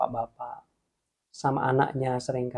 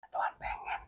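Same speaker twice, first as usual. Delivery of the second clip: breathy whispered aside; 14 dB quieter than usual. Real, not acted. Preacher tells viewers God's wish, whispered. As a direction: whisper the words to yourself. Repeated phrase, first whispered.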